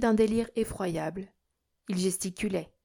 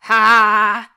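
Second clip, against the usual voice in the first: very loud, held "Ah!"; loud shout of triumph; triumphant cry; 16 dB louder than usual. A narrator's reading. Delirious miser crows after swallowing banknote, shouted "Ah!". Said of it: "cria-t-il".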